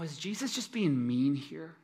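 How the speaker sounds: ordinary talk, nothing marked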